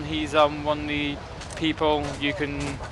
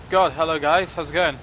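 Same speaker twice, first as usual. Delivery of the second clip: ordinary talk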